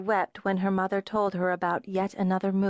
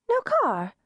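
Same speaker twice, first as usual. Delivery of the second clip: surprised; 11 semitones higher, 3 dB louder than usual. A narrator's reading. Sharon surprised at no car.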